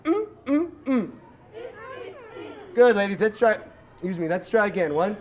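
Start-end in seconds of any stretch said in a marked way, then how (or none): 0.0-1.1 high sassy mm mm mm; sassy mama bear hum. Shown as a demonstration. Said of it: "can you go for me".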